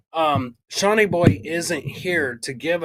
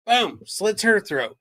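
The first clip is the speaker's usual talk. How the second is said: ordinary talk, nothing marked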